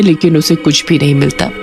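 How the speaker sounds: plain reading voice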